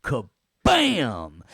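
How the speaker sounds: high, loud cartoon "kabam"; comic-book impact noise; cheesy sound effect. Shown as a demonstration. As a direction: explode on the second syllable. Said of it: "assign your own little sounds".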